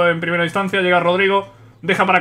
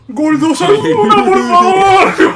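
high, stretched-out yell; overjoyed, slow high wail; ecstatic goal cry; 11 semitones higher, 5 dB louder, much slower than usual. Real, not acted. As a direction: draw the goal call out in disbelief. Celebrating Osasuna's goal.

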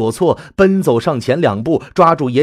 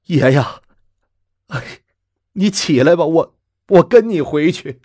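sad sigh; 5 semitones higher, 4 dB louder than usual. Acted. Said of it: "悲哀地叹了口气".

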